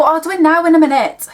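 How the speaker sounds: high, loud, fast comeback; acted retort. Answering mum who is shouting about the room, loud mock retort. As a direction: fire it back at a nagging mum. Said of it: "say my mum's shouting at me".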